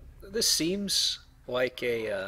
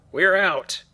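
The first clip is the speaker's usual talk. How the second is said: louder and higher, a burst of relief; relieved cheer; 8 semitones higher, 11 dB louder than usual. Real, not acted. Player cheers as they finally escape. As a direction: celebrate getting out.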